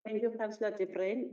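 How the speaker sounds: usual speaking voice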